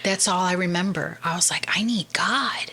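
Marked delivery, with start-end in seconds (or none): 1.8-2.7 hushed whispered realization; whispered inner longing. Retold or imitated. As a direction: breathe it like a secret discovery.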